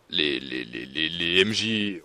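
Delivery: real stammer, low and slow; searching for words. Real, not acted. Forum guide lost for words mid-explanation, stammering. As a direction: stall on a repeated word, voice dropping. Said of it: "j'ai aucun mot en bouche".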